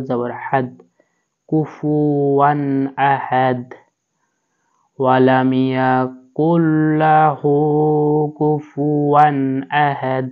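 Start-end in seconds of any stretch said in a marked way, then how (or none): 5.0-10.3 drawn-out tajweed recitation; slow recitation. Shown as a demonstration. Teacher reciting the last verse after spelling it. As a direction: recite slowly, letting each sound ring.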